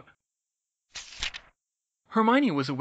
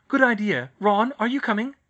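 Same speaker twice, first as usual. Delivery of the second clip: put-on girl voice; 8 semitones higher, 10 dB louder than usual. Hermione voiced.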